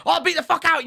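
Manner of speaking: high, loud gamer outburst; retold shout. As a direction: re-enact a startling yell. Acts out shouting that wakes dad.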